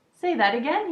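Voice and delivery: high, loud eager request; excited. Acted. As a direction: burst out eagerly. Stuart sits bolt upright.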